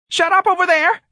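very high, loud screech through the shutters; neighbor screaming. A narrator's reading. Neighbor yells at the quarrelling family, shrill scream. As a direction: yell at the noisy neighbors. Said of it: "Miss Annie screamed through her shutters".

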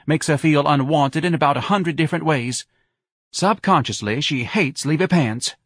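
high-pitched voice of an indignant wife; reproachful wife. A narrator's reading. Wife defends Miss Trixie, accusing husband.

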